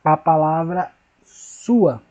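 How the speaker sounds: sua drawn out slowly; slow emphasis. Shown as a demonstration. Teacher showing the word to pupils. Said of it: "Quando a gente fala aqui, ó".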